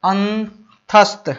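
अंतस्थ drawn out slowly, each sound clear; careful demo word. Shown as a demonstration. Teacher modelling अंतस्थ for students, slow. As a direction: pronounce अंतस्थ slowly and distinctly. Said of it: "ध्यान रखिएगा".

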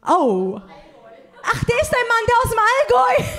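high, loud, half-laughing shout; sudden loud, high surprise; shocked realisation. Real, not acted. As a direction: caught out, blurt it in embarrassment. Surprised realising husband present.

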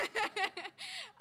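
high giggle; amused laugh. Real, not acted. Poet admitting Tahani told her to, amused laughing.

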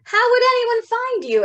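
high, loud bird voice; a chirpy pigeon asking. Acted. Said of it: "asked a pigeon".

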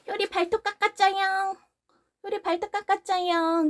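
put-on high baby talk, "깎았자영" cooed; voicing the dog in baby talk; cutesy baby talk. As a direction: talk like a cute toddler.

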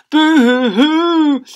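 high, slow, loud mock wail; baby boo-hoo crying. Baby wailing at birth, loud hammed-up boo-hoo. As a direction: bawl it out like a huge baby. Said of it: "burst into tears".

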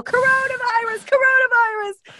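mock yell. Retelling a heckler, high mock yell. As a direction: yell it like a heckler across the street. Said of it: "Or someone yell".

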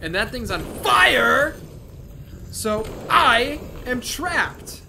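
alarmed. Real, not acted. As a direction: panic as you realize you're trapped. Panic in game fight.

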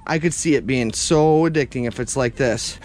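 normal talking voice

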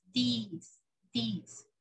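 "This" said softly as "these", long EE; plural form, long EE. Shown as a demonstration. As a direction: softly stretch "this" to "deees", twice. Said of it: "el que es plural". ("this" con I larga, en voz baja)

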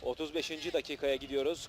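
normal talking voice

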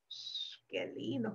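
soft hushed aside. Acted. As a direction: hush as if not to wake it. Sunbathing sheep, calm.

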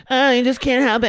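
high, loud mock voice; mock her in a squeaked-up pitch. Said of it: "she starts mocking Lisa".

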